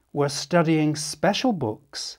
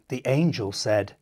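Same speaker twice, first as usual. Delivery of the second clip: usual speaking voice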